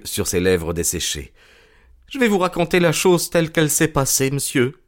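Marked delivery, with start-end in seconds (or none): 2.1-4.7 lightly voiced, submissive thief; a hint of a nervous confessor; cowed culprit confessing. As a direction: own up meekly to the detective.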